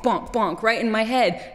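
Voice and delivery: usual speaking voice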